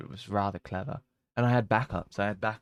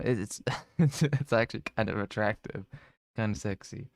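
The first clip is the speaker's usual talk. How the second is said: normal talking voice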